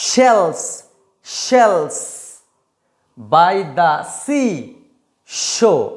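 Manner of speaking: slow careful she sells seashells; teacher modelling a tongue twister; tongue twister drill. Shown as a demonstration. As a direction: separate each sh and s sound carefully. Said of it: "প্রত্যেকটা উচ্চারণকে ভালো করে শোনার চেষ্টা করো".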